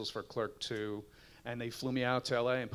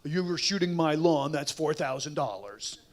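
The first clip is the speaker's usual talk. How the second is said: mock LA homeowner; 3 semitones higher, 4 dB louder than usual.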